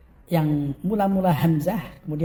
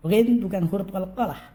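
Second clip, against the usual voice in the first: usual speaking voice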